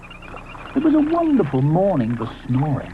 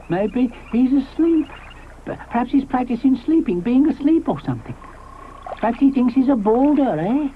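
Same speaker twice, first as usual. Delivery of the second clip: musing Mister Ichthyosaurus; 9 semitones higher than usual. Acted. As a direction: wonder aloud, dotty.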